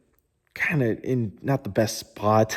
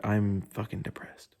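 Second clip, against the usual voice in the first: ordinary talk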